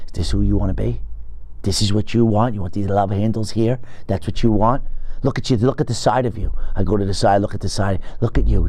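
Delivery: mock your own reflection, disgusted; self-scolding. Scolding himself in mirror.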